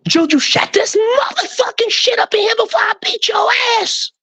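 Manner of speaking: very high screaming threat at the dog; shrieked mock threat to the puppy; furious shout. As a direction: blow up at the dog with a shrieking yell. Scolding howling dog at night.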